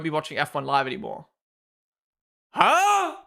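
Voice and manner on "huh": a sudden, high incredulous "Huh?"; surprised huh. Real, not acted. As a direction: thrown off, squeak it out. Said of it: "reacting to chat".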